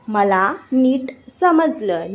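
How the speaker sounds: slow, with gaps between words; slow teaching repeat. Shown as a demonstration. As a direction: stretch it out for beginners. Teacher repeats slowly for learners. (धीरे-धीरे, रुक-रुक कर)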